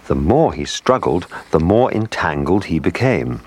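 plain reading voice

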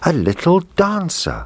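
put-on high, delighted girl's voice; a girl thrilled by a toy; girl delighted with the dancer; happy little girl. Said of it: "cried out happily".

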